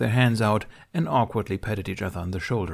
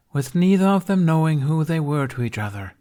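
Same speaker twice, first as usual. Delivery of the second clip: weary stage narrator; 7 semitones higher, 5 dB louder than usual. A narrator's reading. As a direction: recite it like a tired stage king. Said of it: "she repeated for the tenth time in defeat".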